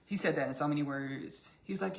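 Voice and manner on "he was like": ordinary delivery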